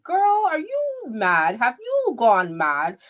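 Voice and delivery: high, drawn-out mock incredulity; exaggerated disbelief. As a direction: stretch the words in theatrical high-pitched outrage. Mock scolding of absent B Taylor.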